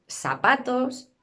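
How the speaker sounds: "zapatos" with s for z, sapatos; seseo. Shown as a demonstration. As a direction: swap the th of "zapatos" for an s. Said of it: "estas letras a un solo sonido".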